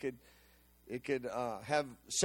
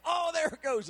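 pained surprise; 9 semitones higher than usual. Retold or imitated. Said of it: "I go".

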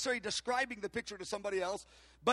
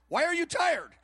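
higher, louder mock-incredulous question; 6 semitones higher, 5 dB louder than usual. Speaker questioning his tired friend, incredulous. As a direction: retell it as a loud, baffled demand.

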